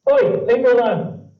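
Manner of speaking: high, loud squeaky cat yelling at you. Shown as a demonstration. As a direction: shriek it like an annoyed cartoon cat. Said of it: "this is what my voice looks like".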